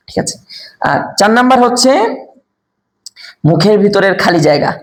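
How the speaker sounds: normal talking voice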